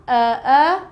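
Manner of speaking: long central "er" vowel, said twice; "er" vowel sound. Shown as a demonstration. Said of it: "two different sounds".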